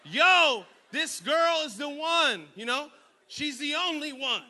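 mock smitten teenager; smitten teen. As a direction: swoon over her. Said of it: "you're thinking, like".

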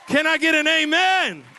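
loud call for an amen; rally the room, loud; preacher call. Real, not acted. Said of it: "calls congregation".

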